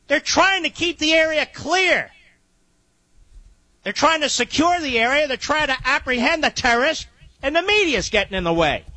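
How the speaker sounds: high, agitated reporting; urgent and riled up; breathless outrage at the media; worked up. Real, not acted. Host worked up over scanner news.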